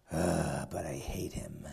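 very low, hushed grumble; acted grudging loathing. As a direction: drop into a near-whisper of distaste. Ahab grudgingly admits hated prophet exists.

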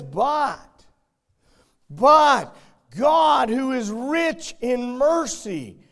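loud, slow, high-pitched fire-and-brimstone preaching; preaching at full force. Acted. Preacher warning the congregation of hell, in full-force preaching. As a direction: thunder each word of warning out over the church.

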